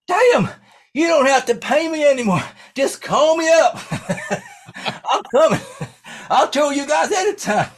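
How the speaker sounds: high, loud whooping local, breaking into laughs; wasted Orville. Retold or imitated. Drunk driver thanking the band, high hollering imitation. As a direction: holler it as an overjoyed drunk small-towner. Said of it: "He's, he's wasted".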